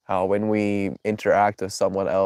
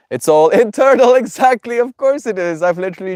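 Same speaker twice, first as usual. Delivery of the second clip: laughing; 12 semitones higher, 9 dB louder than usual. Real, not acted. Laughing realization, high and loud.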